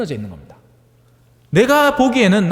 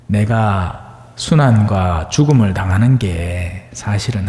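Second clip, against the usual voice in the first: low, calm, gentle talking-to; gentle explaining; 7 semitones lower than usual. Retold or imitated. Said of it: "이렇게 조곤조곤 이야기를 했을지 모릅니다".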